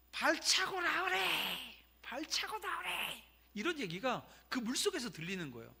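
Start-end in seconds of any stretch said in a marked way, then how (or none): 0.1-3.2 high-pitched, drawn-out Gyeongsang holler; loud Gyeongsang-dialect shout. Retold or imitated. Older boy shouting to a drowning child, loud Gyeongsang dialect. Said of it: "큰 소리로 그 경상도 사투리로".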